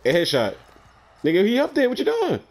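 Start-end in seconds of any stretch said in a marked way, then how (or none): 1.2-2.4 high-pitched, urgent exclamation; alarmed callout. Real, not acted. Player warning a teammate of an enemy upstairs, alarmed and high-pitched. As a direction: call it out in disbelief, voice jumping up high.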